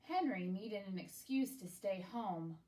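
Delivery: usual speaking voice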